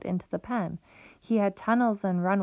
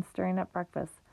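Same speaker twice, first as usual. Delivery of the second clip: usual speaking voice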